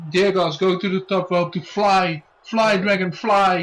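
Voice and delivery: building to a shout, fly Dragon fly; excited commentator. Acted. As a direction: hype up the leap off the top rope. Hyped call of top rope dive.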